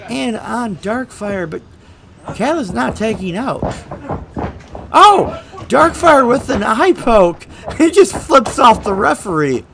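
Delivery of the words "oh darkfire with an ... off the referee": sudden loud cry of surprise; excited shout. Acted. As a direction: yell it out as the foul happens. Sudden foul in the match.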